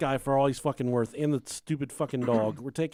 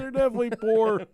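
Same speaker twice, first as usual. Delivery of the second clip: mocking echo; 7 semitones higher, 4 dB louder than usual. Echoing friend's remark louder.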